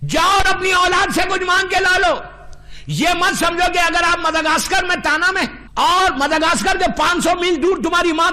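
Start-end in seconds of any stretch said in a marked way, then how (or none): 0.1-2.2 forceful repeat. Acted. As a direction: hammer the line home to the crowd.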